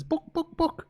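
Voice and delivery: high clucking "book, book, book"; chicken voice. Acted. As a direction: squawk it like a chicken asking. Chicken voice in joke.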